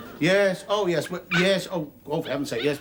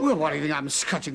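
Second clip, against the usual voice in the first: ordinary talk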